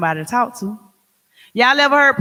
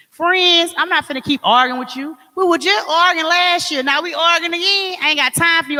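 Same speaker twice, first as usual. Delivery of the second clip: fed-up friend; 6 semitones higher than usual. Acted. Acted quarrel with friends.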